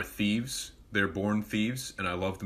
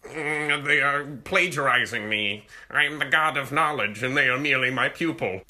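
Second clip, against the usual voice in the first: smug inner voice; 5 semitones higher, 5 dB louder than usual. Pretend. Mock boastful inner voice.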